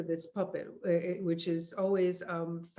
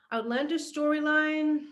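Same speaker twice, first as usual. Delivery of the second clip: higher, louder repeat of the question; puzzled, emphatic echo of the question; loud musing; 8 semitones higher, 7 dB louder than usual. Real, not acted. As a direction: announce the phrase out loud while stumped. Repeats question, still searching.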